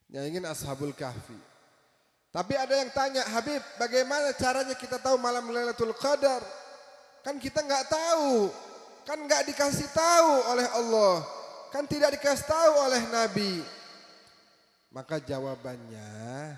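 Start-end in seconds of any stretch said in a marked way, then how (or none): 2.3-13.7 high, loud, rousing sermon delivery; full-force preaching. Acted. Preacher urging the congregation to give and pray, rousing and loud. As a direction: preach it out with fervour to the crowd.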